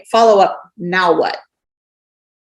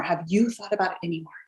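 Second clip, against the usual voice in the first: much softer, gentle repeat; soft follow-up; 9 dB quieter than usual. Shown as a demonstration. Checking back in with a prospect, gently softer. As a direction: ease off and ask it quietly.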